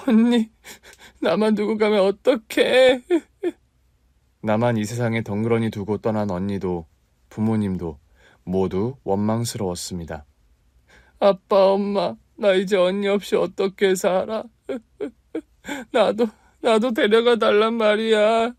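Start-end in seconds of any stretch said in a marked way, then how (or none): 0.1-3.0 high, loud wailing sob; crying out. Acted. To her dead sister's photo, sobbing and loud. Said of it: "얼마나 울었는지 모릅니다". 11.2-18.5 high, slow wailing cry; begging through tears; sobbing plea. Acted. To her dead parents at the columbarium, wailing and sobbing. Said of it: "그렇게 한참을 소리내서 울고 있는데".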